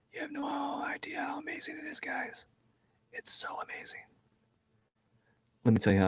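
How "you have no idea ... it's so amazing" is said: soft, awed whisper. Real, not acted. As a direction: whisper it like a secret. Whispers about breathing.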